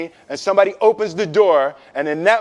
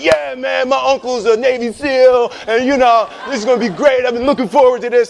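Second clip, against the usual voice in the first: fake bravado; 5 semitones higher than usual. Friends pretending they're not scared, mock bravado. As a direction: put on a brave front, a little fast. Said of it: "pretending like they didn't".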